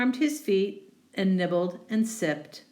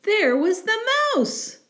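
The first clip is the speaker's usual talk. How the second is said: much higher, louder reveal of the mouse; surprised reveal; 4 dB louder than usual. A narrator's reading. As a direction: gasp it out, the mouse again.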